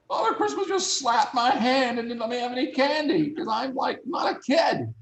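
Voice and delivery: high, squeaky comic indignation; high-pitched aggrieved retelling; mock outrage. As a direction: protest like a wronged child, hammed-up. Acts out indignant complaint to friends.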